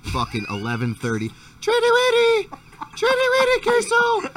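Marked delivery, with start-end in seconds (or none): 1.6-4.3 very high loud sing-song call; high-pitched dog call at night. Retold or imitated. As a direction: squeal it like calling a pet. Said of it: "you gotta do it in a high-pitched voice".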